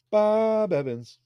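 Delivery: high slow take. Shown as a demonstration. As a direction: sing "Bob Evans" up high, drawn out. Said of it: "I don't know if that's how you say it".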